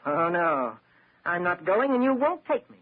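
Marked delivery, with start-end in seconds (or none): 1.2-2.8 desperate defiance. Acted. Cornered suspect defying police.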